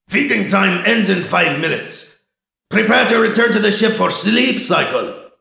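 loud announcement over a loudspeaker; amplified guard voice. Acted. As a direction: call it out over a speaker system. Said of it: "The amplified voice of a Tecta guard".

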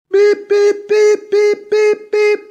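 high, loud mechanical beeps; electronic beeping. Acted. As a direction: sing out the device beeps. Communicator beeping.

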